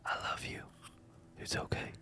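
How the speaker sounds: very soft, tender whisper; hushed, gentle and unhurried; loving reassurance. Retold or imitated. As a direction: whisper it to soothe someone hostile. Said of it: "with so much love".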